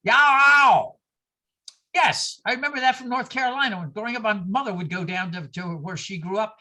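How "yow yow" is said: loud shouted Southern yow; Southern drawl. Retold or imitated. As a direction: holler it like a Southern man. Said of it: "two kinds of Southernese".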